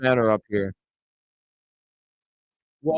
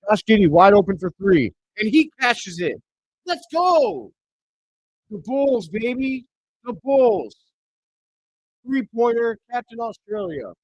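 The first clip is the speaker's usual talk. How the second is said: excited cheering; 6 semitones higher than usual. Real, not acted. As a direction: yell it like your team just scored. Bulls hit a three.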